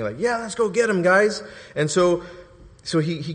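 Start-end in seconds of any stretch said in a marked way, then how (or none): 0.2-1.4 high, eager call to the team; mock rallying cry. Jesus imagined rallying disciples, mock eager cry. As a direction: rouse the gang for a fight. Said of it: "rather than saying".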